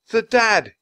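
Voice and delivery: high, loud frightened yell; child's sudden yell at the huge cobra. A narrator's reading. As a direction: Annie cries out in alarm. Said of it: "yelled Annie".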